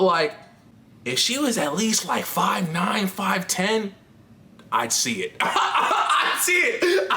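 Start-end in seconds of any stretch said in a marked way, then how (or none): 5.4-7.2 high and drawn out through laughter; laughing admission. Real, not acted. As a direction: crack up while admitting it. Cracking up at own admission.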